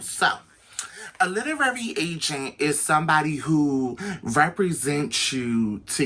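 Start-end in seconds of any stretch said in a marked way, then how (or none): none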